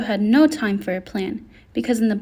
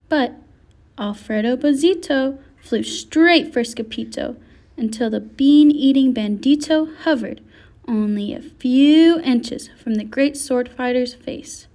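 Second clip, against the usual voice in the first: ordinary delivery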